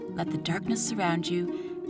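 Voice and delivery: ordinary delivery, nothing marked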